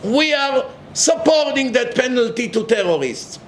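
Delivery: high, prim mock announcement; mocking convert. Mocking lefties who suddenly back the death penalty, high and prim. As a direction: parody a sudden change of heart. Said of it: "some of them begin to open up their eyes".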